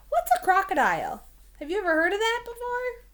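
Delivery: animated kindergarten teacher asking questions; eager teacher questions. Shown as a demonstration. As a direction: draw the kids in with eager curiosity. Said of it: "teacher engaging kids, high excited".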